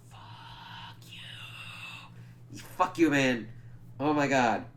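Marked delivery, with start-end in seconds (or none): 0.1-2.6 long, drawn-out, very soft whisper; whispered curse. Real, not acted. Fan reacting to "agree to disagree", drawn-out whispered curse.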